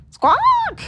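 a very high, loud squawk; the parrot Igor screeches back at the librarian. Acted. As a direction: shriek like an angry parrot. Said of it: "Igor squawked".

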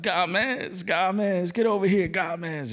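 imitating a loud high call across the room; mock yell. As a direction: bellow the name like a delighted pastor. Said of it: "all at the top of his lungs".

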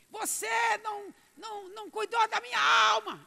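high, loud, anguished wail; desperate shout. Acted. Lost soul screaming at friend who never preached, desperate cry. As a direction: cry out in despair, voice straining.

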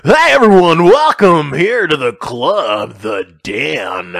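loud, drawn-out strip-club announcer; stripper DJ voice. Pretend. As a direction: boom it out like a club DJ, stretching words. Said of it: "talking in my stripper D J voice".

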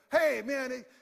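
high, loud nervous rambling; frightened Peter babbling too loud; flustered babbling. Retold or imitated. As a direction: blurt it out in a panic to fill the silence. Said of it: "He's terrified".

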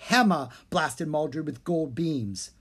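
ordinary delivery

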